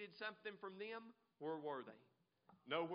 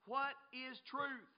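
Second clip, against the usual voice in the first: slow, higher mock question; sarcastic scoff; 5 semitones higher, 4 dB louder, slower than usual. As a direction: draw it out with sarcasm. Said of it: "he was sarcastic".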